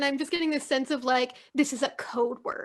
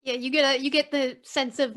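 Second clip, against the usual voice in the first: ordinary talk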